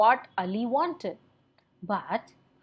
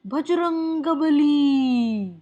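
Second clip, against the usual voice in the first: slow, louder, higher awed cry of the name; surprised gasp; 5 semitones higher, 5 dB louder, slower than usual. A narrator's reading. Moochwala sees a shadow, cries out in surprise. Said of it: "he was very surprised".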